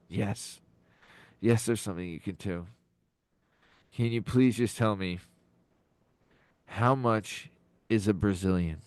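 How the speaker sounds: soft, low and sorrowful; subdued acted distress; pained. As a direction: ask quietly, as if it hurts. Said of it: "asked with a pain visible".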